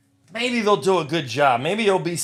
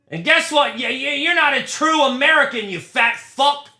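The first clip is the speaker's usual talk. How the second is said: angry rant; 8 semitones higher, 3 dB louder than usual. Real, not acted. Yelling insults at a pulled card.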